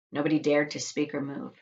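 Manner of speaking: ordinary delivery, nothing marked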